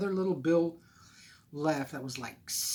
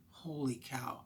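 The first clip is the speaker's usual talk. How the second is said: a stunned little whisper; whispered shock; 7 dB quieter, slower than usual. Retold or imitated. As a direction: breathe it out under your breath, stunned. Staring at empty bank account.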